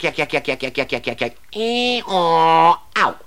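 rapid yak yak then a bray; donkey yak noises. Shown as a demonstration. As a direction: mimic endless chatter and a donkey. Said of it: "Talk the hind leg of a donkey".

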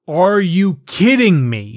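ordinary talk, nothing marked

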